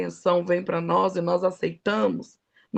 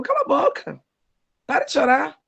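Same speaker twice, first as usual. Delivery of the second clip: sharp, higher-pitched retold scolding; bronca ríspida; 5 semitones higher, 3 dB louder than usual. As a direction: order them brusquely to stop crying. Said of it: "Desse jeito, irmão, desse jeito". (bronca seca, em tom mais agudo)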